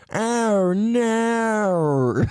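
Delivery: high shouted Australian oh no; mock Australian "no" with rounded vowel; hammed-up Australian oh no; Australian accent. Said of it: "why do you talk like that?".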